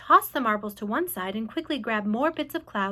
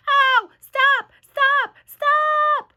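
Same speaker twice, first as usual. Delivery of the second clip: much higher, louder pleading yelps; crying out in pain; 9 dB louder, much slower than usual. A narrator's reading. Centipede pelted by hail, crying high and loud. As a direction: beg them to stop, rising. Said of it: "he cried".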